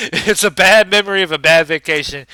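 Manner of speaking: loud, laughing second telling; laughing repeat. Real, not acted.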